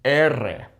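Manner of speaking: Spanish letter name "R" as EH-rreh, trilled; rolled double R. Shown as a demonstration. Naming the double R spelling, trilled "R". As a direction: roll "R" as erre. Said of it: "here is the double R spelling".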